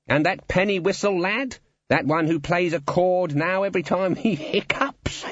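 high, needling character voice; gloating guild boss. A narrator's reading. Guild boss recalling the penny whistle lad, gloating.

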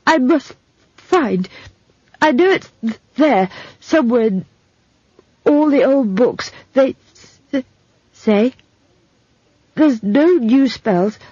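high, halting, earnest stutter; stammering young man, urgent. Acted. Simon confiding his search to Esk, urgent stammer. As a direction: struggle eagerly to get each word out.

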